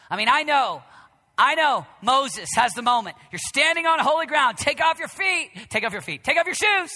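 loud, high preaching shout; fiery sermon shout. Acted. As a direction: thunder it from the pulpit. Preaching at full force.

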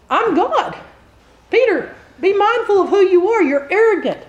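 stern rebuke. Pretend. Imagined Jesus rebuking Peter angrily.

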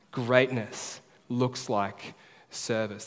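Greatness looks like service, each word drawn out very slowly; very slow, deliberate refrain; very slow emphatic. Shown as a demonstration. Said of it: "that's the big idea".

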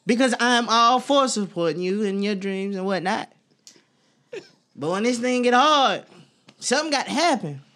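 high, pleading exasperation; what do you want, whining; exasperated. Acted. Acting out frustrated partner.

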